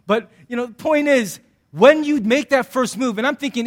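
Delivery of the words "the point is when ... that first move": usual speaking voice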